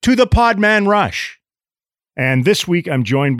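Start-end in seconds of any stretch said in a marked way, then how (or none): 0.0-1.4 pitched up show-name announcement; high, showy podcast announcer. Acted. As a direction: announce the show title with flair.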